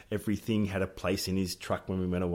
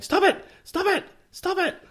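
very high, loud mock pleading yell; frightened yelling; 10 dB louder than usual. Mum begging son to stop shaking boardwalk, high yell. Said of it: "grabbing onto the sides of it going".

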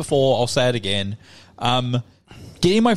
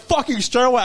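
instant aggression; 9 semitones higher than usual. Retold or imitated. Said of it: "I go from being the nicest person".

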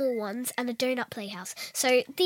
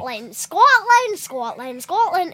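loud, pitched-up repeated Scotland; hammed-up Scottish chant; 6 semitones higher, 12 dB louder than usual. Shown as a demonstration. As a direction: chant Scotland in mock Scots. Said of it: "until we get it perfect".

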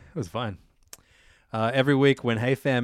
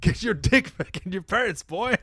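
higher mock scolding; cartoon dad; 5 semitones higher than usual. Cartoon dad telling off his boy, mock scolding. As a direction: rise sharply on the last word.

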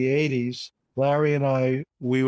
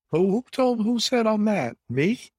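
much higher, playful who-me disbelief; mock indignation; 9 semitones higher than usual. To the interviewer calling him caustic, mock-shocked who-me. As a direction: feign shock at the accusation. Denying being called caustic.

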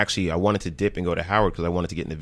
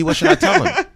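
high, louder innocent whine; clueless squeak; 7 dB louder than usual. Retold or imitated. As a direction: play dumb in a high, louder voice. Quam acts clueless, voice jumps.

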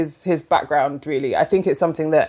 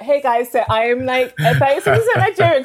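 mock tweet voice; 7 semitones higher than usual. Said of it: "go on Twitter and be like".

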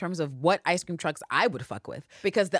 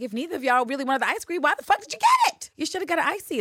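high, loud, incredulous scolding; exasperated rant; 10 semitones higher, 4 dB louder than usual. Retold or imitated. Host ranting at the women over the ice cream, mock outraged. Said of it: "And I'm like".

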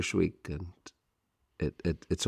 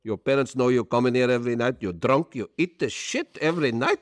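incredulous Turkish owner; 4 semitones higher than usual. Retold or imitated. Owner scoffs at student paying, high accented disbelief. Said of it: "he goes".

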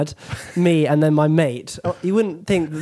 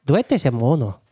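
mock Spanish. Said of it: "front of house at a Spanish restaurant in Barcelona".

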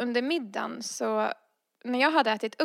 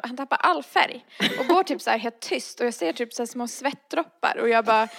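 usual speaking voice

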